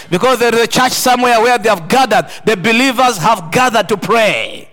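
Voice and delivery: loud, fervent preaching; preaching at full force. Acted. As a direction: thunder the rebuke over the congregation.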